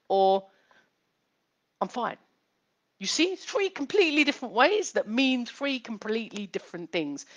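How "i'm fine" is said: soft, subdued "I'm fine"; quiet "I'm fine" with little push; third way, subdued. Shown as a demonstration. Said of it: "three different ways of saying".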